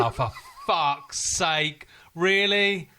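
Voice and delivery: high, loud, drawn-out mock exasperation; imagined exasperation. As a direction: act out their disbelief, stretching the words. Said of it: "you'd have looked at me and gone".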